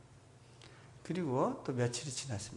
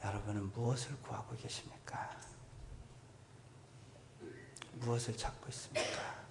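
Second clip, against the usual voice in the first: 작고 부드럽게 묻는 목소리; 기도로 이끌듯 속삭이며; 조용한 초대; 10 dB quieter than usual. Real, not acted. 회중에게 기도로 초대. (회중을 기도로 이끄는 설교자, 조용하게)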